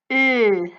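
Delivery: Ö held loud, lips loosely rounded; German Ö umlaut. Shown as a demonstration. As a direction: blend "oh" and "eh" into one long Ö. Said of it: "which we call in German also as the".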